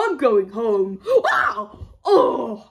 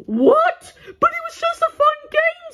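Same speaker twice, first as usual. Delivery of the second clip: whiny high protest. Acted. Arrested child protests.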